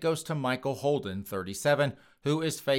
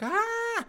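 high falsetto mock shriek of panic. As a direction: squeal like a panicked woman, hammed up. She's freaking out.

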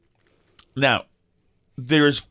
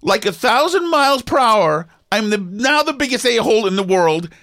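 worked-up retelling; 6 semitones higher, 4 dB louder than usual. Real, not acted. Sudden shame and excitement.

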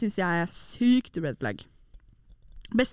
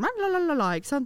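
eastern Norwegian chatter; 6 semitones higher than usual. Retold or imitated. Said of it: "Så når jeg snakker østlending".

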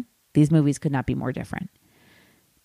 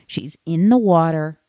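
ordinary talk

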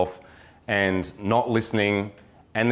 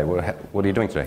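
rushed nervous talk; 2 semitones lower, faster than usual. Shown as a demonstration. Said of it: "Speaking too fast".

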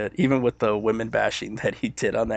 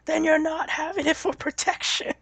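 quoting a comedian. Retold or imitated. Retelling comedian's bit.

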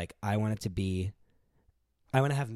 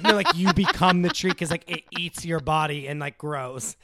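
eager explaining; 5 semitones higher, 9 dB louder than usual. Retold or imitated. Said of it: "I was like".

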